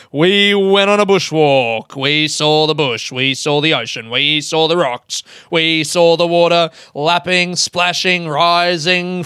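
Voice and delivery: hammed-up race caller, sustained and driving; announcer voice rolling on without letup; horse announcer. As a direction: narrate it like a live horse race. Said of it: "I don't know why I did that voice".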